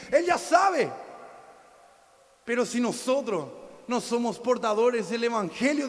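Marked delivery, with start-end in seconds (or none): 0.1-0.9 shouting. Real, not acted. Preacher hammering home a point.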